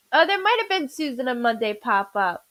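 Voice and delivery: normal talking voice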